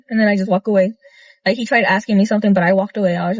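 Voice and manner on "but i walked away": ordinary talk, nothing marked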